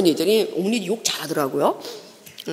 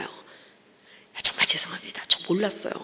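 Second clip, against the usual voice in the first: soft unvoiced sorry; meek whisper to the old woman; whispered apology; 3 semitones lower, 7 dB quieter than usual.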